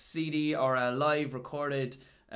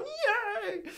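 very high mock squeal; excited fan cheer. Reactor imitating Marvel fans watching trailers, excited squeal. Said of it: "like all those Marvel fans watching the trailers".

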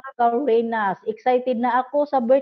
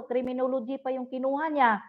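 ordinary delivery

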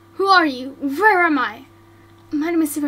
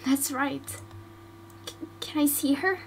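ordinary delivery

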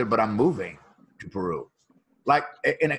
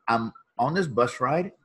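ordinary delivery

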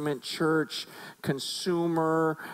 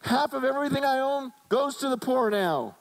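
high, excited promise to the crowd; eager Zacchaeus; 10 semitones higher than usual. Retold or imitated. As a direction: announce it proudly to everyone. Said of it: "he goes".

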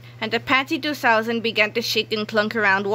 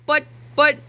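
stammered; 6 semitones higher, 5 dB louder than usual. Acted. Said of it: "Harold stammered".

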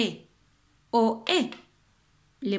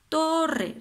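"Torre" más alto, tónica marcada; énfasis en la rima; 7 semitones higher than usual. Shown as a demonstration. (profesora resalta "Torre" para la rima)

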